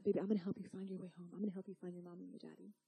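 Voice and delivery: soft, quick soothing promise; tender reassurance. Acted. Stranger promising the baby to find her parents, softly tender. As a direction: reassure a lost child gently.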